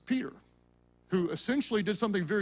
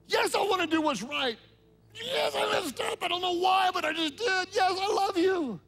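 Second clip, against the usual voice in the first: higher, pleading yes; earnest Peter; 6 semitones higher than usual. Retold or imitated. Peter answering Jesus after failing, pleading higher. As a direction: plead it, rising to a cry.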